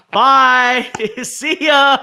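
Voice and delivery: high, loud, laughing send-off; mock farewell. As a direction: call out a gleeful goodbye through laughter. Acting out a taunting send-off.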